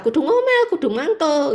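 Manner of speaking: high squeaky nagging burst; mock grumble. Voicing grumbling at home. (omelan melengking tinggi)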